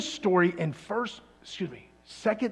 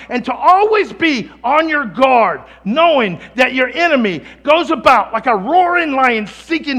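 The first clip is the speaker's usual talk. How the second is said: louder, higher impassioned prayer-sermon; fervent preaching; 6 semitones higher, 11 dB louder than usual. Acted. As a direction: pour it out like a preacher at full force. Preaching at full force.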